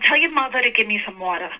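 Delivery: everyday New York accent, dropped r's. Shown as a demonstration. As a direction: say it like a real New Yorker. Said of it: "you know, for New Yorkers".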